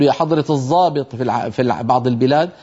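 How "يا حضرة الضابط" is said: الضابط said as an emphatic z, the Egyptian way; colloquial z. Shown as a demonstration. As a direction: address the officer in street Arabic. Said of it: "مثلًا بيقول". (الضابط بالظاء العامية)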